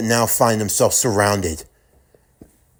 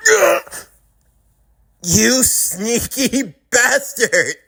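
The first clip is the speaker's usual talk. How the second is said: pained villain cry; 12 semitones higher, much slower than usual. Acted. Mahito impaled by Frank's blade.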